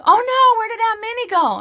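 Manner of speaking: very high, loud squeaky child imitation; mock panicked kids. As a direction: squeal it like alarmed little kids, hammed-up. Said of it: "And they're like".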